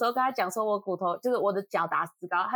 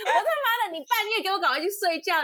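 exasperated outburst; 10 semitones higher than usual. Real, not acted. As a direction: vent your anger at the late call. Angry rant about friend's call. (气愤地高声大骂)

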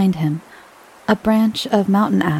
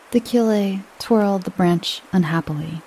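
plain reading voice

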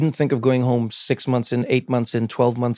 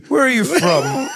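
mock alarmed question; slower than usual. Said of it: "Excuse me".

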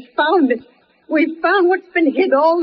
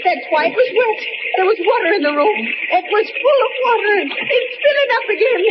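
rising terror, pitch climbing higher and higher; panicked; 7 semitones higher than usual. Acted. Trapped aunt shrieks to the boy as water floods in, rising panic. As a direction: panic building as the water rises.

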